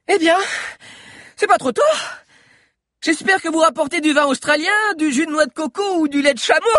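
put-on very high, loud girl's voice; cheeky girl. A narrator's reading. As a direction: greet late rescuers with loud, high-pitched cheek. Girl cheekily greets her rescuers.

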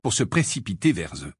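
ordinary delivery

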